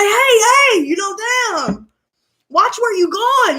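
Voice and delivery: a tiny bug telling her off; high squeaky hey hey from an annoyed moth; cheeky moth voice. Retold or imitated. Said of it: "he was like".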